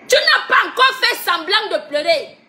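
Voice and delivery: mocking question, laughing through it; laughing taunt. Real, not acted. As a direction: laugh at her while you ask. Mocking a crying opponent. (moquerie en riant)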